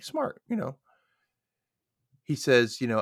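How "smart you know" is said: high, approving remark; a lightly approving aside. Real, not acted. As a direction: sound impressed. Reacting to Graham's plan.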